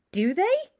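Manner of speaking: put-on astonished young wizard; amazed. A narrator's reading. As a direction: react in open amazement, pitch leaping up. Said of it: "Ron sounded amazed".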